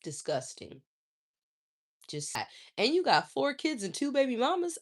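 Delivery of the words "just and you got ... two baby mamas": usual speaking voice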